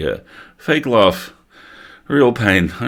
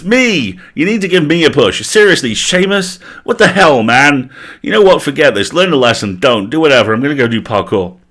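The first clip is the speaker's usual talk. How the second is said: loud, high frustrated rant; hammed-up tantrum of a wrestler; indignant rant; 5 semitones higher, 4 dB louder than usual. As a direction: vent at the boss then storm off. Ghost rants, storms off.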